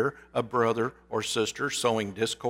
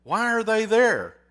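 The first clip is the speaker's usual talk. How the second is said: raised voice, high and loud; alarmed, frustrated boss; 7 semitones higher, 7 dB louder than usual. A narrator's reading. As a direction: demand it in exasperated alarm. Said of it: "more than just a little frustrated".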